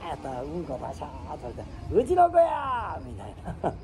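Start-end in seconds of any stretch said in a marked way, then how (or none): 1.9-3.0 high, slow, stretched mock discovery; high drawn-out fake gasp of recognition; mock surprise. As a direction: feign realising it's your child.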